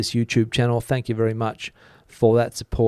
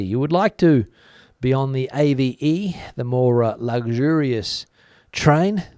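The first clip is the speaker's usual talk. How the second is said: normal talking voice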